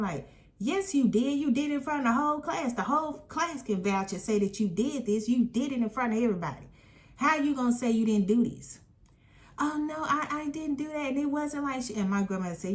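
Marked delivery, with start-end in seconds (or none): 0.6-8.8 hammed-up kid calling out a liar; retold outraged comeback; indignant retort. Child confronting lying teacher. 9.6-11.9 much higher, whiny mock of the teacher denying; squeaky mocking denial. Teacher denying, mocked by narrator.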